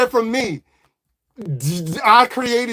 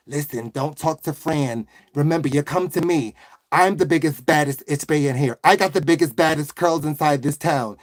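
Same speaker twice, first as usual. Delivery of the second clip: lower-voiced, bragging Italian-American woman; 7 semitones lower, 4 dB quieter than usual. Waitress warning customer off Fran, Italian woman's accent, pitched lower. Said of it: "Okay, an Italian woman".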